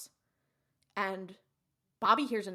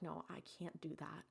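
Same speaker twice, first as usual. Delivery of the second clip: soft, subdued acted refusal; understated, muted reluctance; hushed refusal; 3 semitones lower, 14 dB quieter than usual. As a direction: refuse very quietly. Retold husband refusing dying wife.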